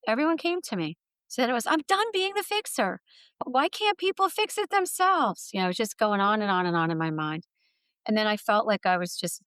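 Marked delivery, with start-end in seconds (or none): 1.7-5.5 much higher, pleading inner complaint; exasperated inner voice. Retold or imitated. Burned-out woman's inner voice, exasperated and high. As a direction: voice the resentment of always being the fixer.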